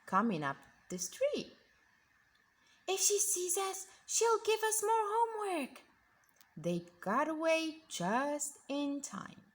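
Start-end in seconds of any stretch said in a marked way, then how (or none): 2.9-5.8 put-on scared kids. Kids fearing the witch.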